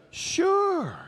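a very high, drawn-out "Sure"; slyly bait your hungry brother; sly schemer. Retold or imitated. Jacob bargaining with Esau, sly and high. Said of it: "he was like".

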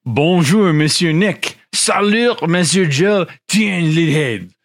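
hammed-up French accent, high and bright; mock French accent. Host greeting co-hosts in a mock French accent. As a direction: greet them like a cartoon Frenchman. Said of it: "a absolutely racist caricature of French".

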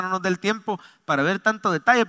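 usual speaking voice

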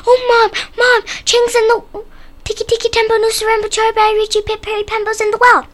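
high, urgent cry on one pitch; urgent alarm. A narrator's reading. Chang telling his mom his brother is in the well, high urgent cry. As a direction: rattle out the alarm, high and loud at first. Boy raising the alarm to mother.

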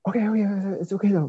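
calm the stranger down nervously; nervous placating. Retold or imitated. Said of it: "I was like".

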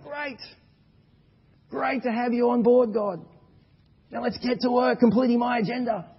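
high, loud, overeager voice of a naive believer; mock eager self. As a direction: gush at God like he's a new hire. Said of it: "I said something like".

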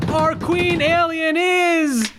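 high, slow announcer reveal; drum-roll reveal, high and slow; grand announcement. Acted. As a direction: announce it like a game-show winner. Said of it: "can I get a quick drum roll?".

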